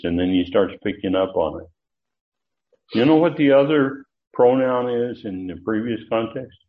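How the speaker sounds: usual speaking voice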